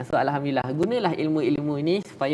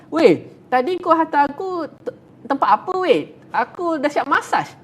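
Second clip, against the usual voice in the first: higher and louder, a playful friend; raised, chatty friend's voice; mock call to a friend; 9 semitones higher, 4 dB louder than usual. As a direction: call out cheerfully to your buddy. Green person calls friend after massage.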